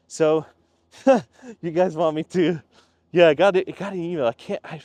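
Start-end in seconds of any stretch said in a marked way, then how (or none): 1.0-1.5 quick burst of laughter; short laugh. Real, not acted. Vlogger laughing at his HOA joke, loud burst. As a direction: laugh at your own joke.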